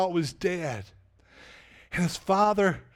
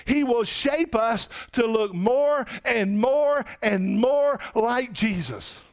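slow emphatic preaching; 3 semitones higher than usual. Real, not acted. Preaching climax.

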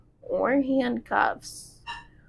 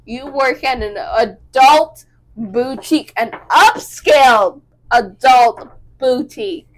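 slow, louder correction; stern correcting boss; 7 dB louder, slower than usual. Retold or imitated. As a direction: firmly set the record straight, slowly. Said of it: "the owner was like".

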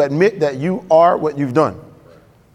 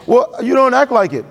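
mock accuser; 6 semitones higher than usual. Voices accuser, mock objection.

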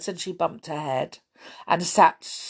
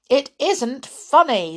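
sharp, louder protest from Tom; angry; 7 semitones higher, 6 dB louder than usual. Acted. Tom to Amelia after the chair trick, angry and loud. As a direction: snap it back, offended. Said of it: "said Tom angrily".

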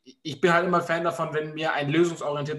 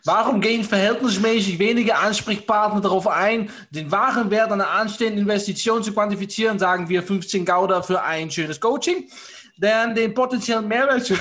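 put-on playful Dutch accent, reading to the group; mock Dutch accent; 3 semitones higher, 3 dB louder than usual. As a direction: read the question like a Scheveningen local doing a Dutch accent. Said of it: "mit Scheveninger Akzent die Frage von vorher vorlesen".

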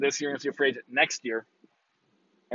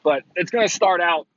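usual speaking voice

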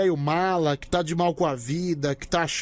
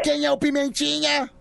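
put-on baby talk; 6 semitones higher than usual. Host coos baby talk.

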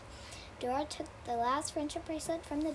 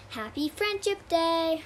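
cheerful exclamation; 5 semitones higher, 8 dB louder, slower than usual. A narrator's reading. Story's cheerful closing line.

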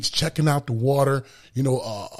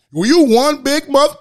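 loud, high mock heckle, voice pitched way up; imitating a brash stranger shouting a crude remark; belligerent stranger; 11 semitones higher, 8 dB louder than usual. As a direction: bellow it like a rude guy on the street. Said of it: "very belligerent".

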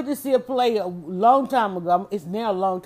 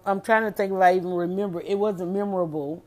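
ordinary talk

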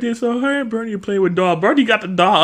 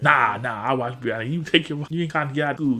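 lightly voiced lower man, brushing her off; low lazy brush-off, a touch deeper; imitating Birdie; 6 semitones lower, 5 dB quieter, faster than usual. Uncle putting off the little girl, low and lazy. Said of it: "Birdie was like".

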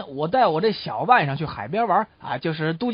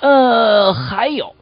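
coachman adding more; 9 dB louder, much slower than usual. Acted. Coachman with one more clue for the writer, loud hesitant stall. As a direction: hold the filler loudly before the afterthought. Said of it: "赶车的人继续说道".

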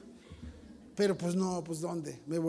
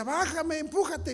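put-on high childish pleading; pleading little boy voice, pitched high; whiny kid sister plea; 7 semitones higher, slower than usual. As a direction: beg like a small boy stuck up on the seesaw. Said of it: "Y yo le decía".

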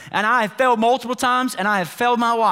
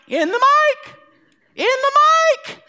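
much higher, hammed-up groom at the mic; mock weepy groom; 4 dB louder than usual. Pastor mocking a crying groom at weddings, high hammed-up voice. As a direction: parody a teary groom gushing.

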